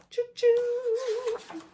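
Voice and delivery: high, light toy-train choo-choo; train whistle. Acted. Reader jokes about losing a train of thought with a high choo-choo.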